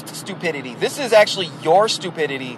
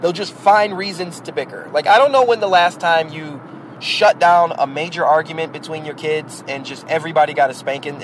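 ordinary talk, nothing marked

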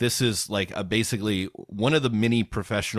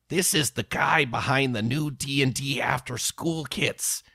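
mock outraged commenter, higher; 5 semitones higher than usual. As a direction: read it like a fuming commenter. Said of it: "Here's one".

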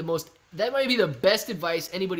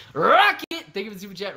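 excited shout-out; 4 semitones higher, 6 dB louder than usual. Real, not acted. Greeting super chat donor.

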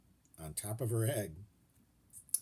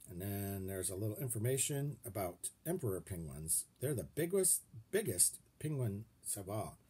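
usual speaking voice

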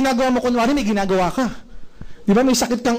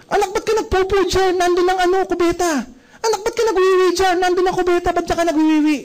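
higher-pitched exasperated mum voice; annoyed parent scolding; 7 semitones higher than usual. Retold or imitated. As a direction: scold the noisy kid, fed up.

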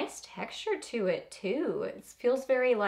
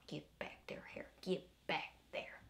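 whispered scolding; 5 semitones lower, 14 dB quieter than usual. Real, not acted. Scolding her unruly hair.

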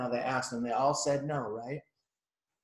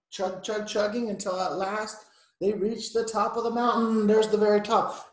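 raised, building picture-book narration; story narration for children; 6 semitones higher, 6 dB louder than usual. A narrator's reading. Reading engine's climb to a child, excited narration. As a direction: build up to reaching the summit.

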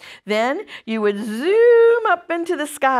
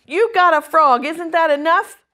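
raised, louder voice of a cross sister; scolding command; 6 semitones higher, 6 dB louder than usual. A narrator's reading. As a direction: tell your brother off, pitched up. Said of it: "commanded Fern".

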